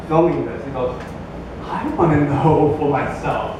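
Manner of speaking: ordinary talk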